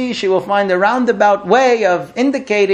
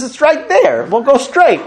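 brisk, higher, laughing husband voice; husband's answer; 7 semitones higher, 3 dB louder than usual. Retold or imitated. Husband answering his wife about the path, amused and higher. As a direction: toss it off like an obvious answer.

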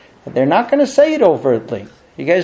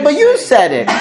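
higher, louder, laughing protest; defensive husband; 8 semitones higher, 4 dB louder than usual. Retold or imitated. Husband defending himself to his wife, higher and laughing. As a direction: play the husband who thinks he's innocent.

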